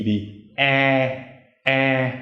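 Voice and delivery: "eh" drawn out through the nose; same nasal voice on a long "eh"; nasal vowel. Shown as a demonstration. Teacher has learners practice the nasal E, nasal vowel. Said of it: "try that with the E".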